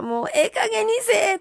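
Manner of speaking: a light play-act of shouting; mock outburst. Host acting out shouting in frustration, mock. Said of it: "言うてみよう".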